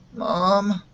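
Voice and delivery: thick mutter. A narrator's reading. Feverish boy telling his mother he'll sleep, thick mutter. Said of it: "he muttered thickly".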